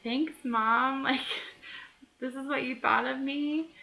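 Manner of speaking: lightly voiced sulky grown-up daughter; mock hurt thanks, pitched a bit up; grown daughter's reproach. Imagined grown daughter thanking mom for the book, mildly mock wounded. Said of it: "she's like".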